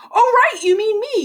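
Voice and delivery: high, caught-out start; sudden realisation. Acted. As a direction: catch yourself mid-lie. Fake Santa catching himself.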